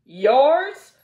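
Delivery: a shocked shriek; scream. A narrator's reading. Aunt Polly shrieking at Pollyanna in shock. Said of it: "Miss Polly screamed".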